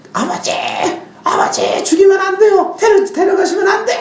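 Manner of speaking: high-pitched, desperate shouted prayer; screamed plea. Retold or imitated. Sister begging God for her brother's life, screaming. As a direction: cry out to God, voice soaring far above normal. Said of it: "막 소리를 지르는 거예요".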